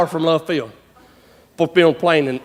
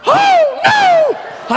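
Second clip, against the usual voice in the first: very high, drawn-out comic holler; alarmed shout. Retold or imitated. Roper sees the cow getting up, alarmed shout. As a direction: wail it out in mock panic. Acts out seeing the cow get up.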